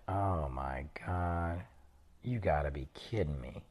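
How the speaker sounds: slow, low, hushed disbelief; stunned disbelief. Retold or imitated. Tourist hearing the gang story, hushed and stunned. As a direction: let it sink in, barely audible.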